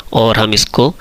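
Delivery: ordinary delivery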